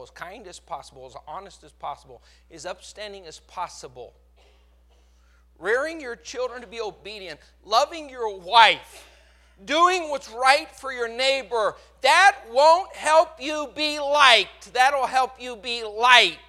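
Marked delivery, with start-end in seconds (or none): 5.6-16.4 slow, loud, high preaching; preaching build. Acted. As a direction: hammer each line home, slowly.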